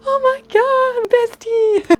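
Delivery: very high, slow squeal; drawn-out squealing greeting; excited squeal. Retold or imitated. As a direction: stretch it out in delight. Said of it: "I was like".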